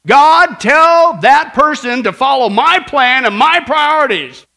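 loud, high, drawn-out bossy order; mock imperious demand. Martha ordering God to back her plan, mock bellowed demand. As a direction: hammer out each word loudly and slowly.

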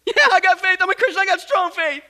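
high, loud, desperate insistence; frantic assurance. Acted. Tourist claims strong faith to be rescued, frantic. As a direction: insist at a panicked shout.

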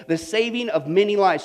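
usual speaking voice

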